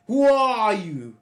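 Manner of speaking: a high, slow, suspicious "who are you"; imitating northern attitude. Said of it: "kind of attitude".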